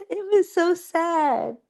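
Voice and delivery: high and wavering, half laughing through tears; fighting back tears while retelling the film; choked-up, tearful telling; teary voice. Real, not acted. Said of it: "I'm actually tearing up right now".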